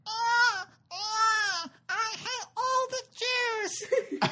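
put-on shrill wailing baby cry, very high. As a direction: wail like an angry newborn. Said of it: "an impression of a baby crying".

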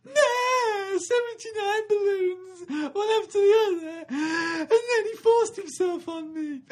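high, loud laughing sob, slowing down; hysterical victim wailing. Acted. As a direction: wail to the officer through sobs and laughter. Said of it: "You're going".

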